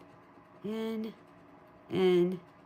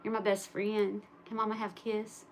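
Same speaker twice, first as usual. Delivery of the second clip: tender pet talk; 7 dB quieter than usual. Real, not acted. Mother asking her dog for a kiss, soft cooing. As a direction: coo softly to the dog.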